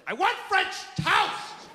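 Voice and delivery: high, slow demanding yell; drawn-out mock tantrum cry; angry little girl. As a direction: imitate a toddler's furious demand. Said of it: "she's a little ball of anger".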